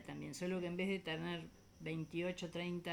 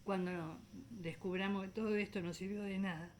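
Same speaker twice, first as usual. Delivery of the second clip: normal talking voice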